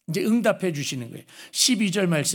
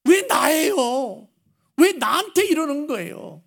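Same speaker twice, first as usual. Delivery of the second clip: mock protest; 8 semitones higher, slower than usual. A believer protesting to God, in a high drawn-out plaintive voice. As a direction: whine at God, why me. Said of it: "항의를 지금 하고 있는 거예요".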